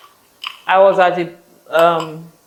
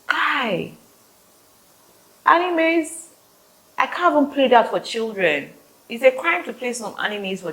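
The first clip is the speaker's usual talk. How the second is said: exasperated; 7 semitones higher than usual. Real, not acted. Exasperated rant.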